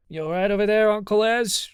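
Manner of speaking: loud, high check-in across the room; calling out. A narrator's reading. Chansey calls to wailing Uncle Ez, loudly.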